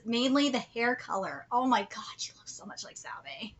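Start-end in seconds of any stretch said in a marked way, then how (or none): 1.5-3.5 whispered amazement. Real, not acted. Amazed aside about the resemblance.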